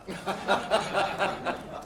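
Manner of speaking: a short laugh that trails down; laughter. Real, not acted. Joke teller laughing mid-punchline. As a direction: break off into a laugh.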